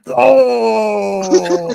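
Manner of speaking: high, drawn-out imitated wail; mock intro yell. Co-host teasing the host about his intro, high mock yell. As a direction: let out a long mock howl. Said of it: "it's just you".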